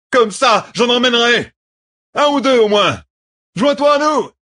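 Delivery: high, loud rallying yells of a battle-mad officer; frenzied shout. A narrator's reading. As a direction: holler wildly over the fighting. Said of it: "lança Lidrin". (hurlements exaltés, voix aiguë et forte)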